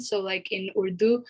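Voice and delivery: normal talking voice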